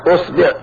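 أصبع said "usbi'", u then i; damma, then kasra. Shown as a demonstration. Teacher showing damma forms, أصبع as "usbi'". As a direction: say أصبع with u on hamza, i on baa. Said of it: "ثم نأتي ضم الهمزة".

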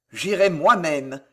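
plain reading voice

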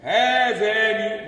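هذان stretched slowly with the long vowel held; drawn-out recitation. Shown as a demonstration. Teacher showing students how to pronounce هذان, drawn out. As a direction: draw هذان out like Quran recitation. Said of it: "أنت بتقول هذا ولا بتقول هذا".

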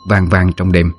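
plain reading voice

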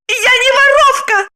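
very high, offended squeal. A narrator's reading. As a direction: squeal the denial, hurt and indignant. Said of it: "взвизгнула она". (обиженный визг)

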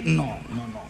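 ordinary talk

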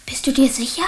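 anxious hushed whisper. Acted. Boy whispers in hiding from the police. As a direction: whisper so no one outside hears.

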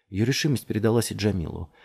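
ordinary delivery

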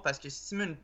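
ordinary delivery

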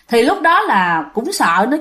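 usual speaking voice

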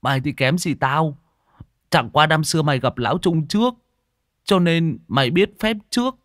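lightly voiced cocky lad; boastful Tý. Tý boasts to Đen.

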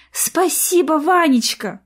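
"Ванечка" bright, high and loud with a falling end; joyful thanks. Acted. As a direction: beam out "Спасибо, Ванечка!". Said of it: "радостно улыбнулась Арина".

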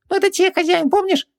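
squeaky genie, very high. A narrator's reading. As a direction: pitch way up like a tiny genie. Genie voice held.

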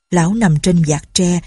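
usual speaking voice

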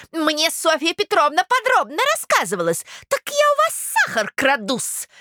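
high, indignant accusing voice; affronted provincial lady. Acted. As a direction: demand an answer as an insulted gossip. (оскорблённая дама, высоко и возмущённо)

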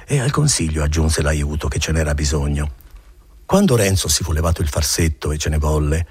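ordinary delivery

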